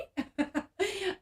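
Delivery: short bright laugh at the silly question; amused laugh. Real, not acted. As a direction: burst out laughing.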